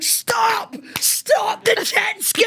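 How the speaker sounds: high, slow, desperate shouting; frantic shout. Retold or imitated. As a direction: yell it like he's being dragged out to sea. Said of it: "Pete's slapping me on the back, going".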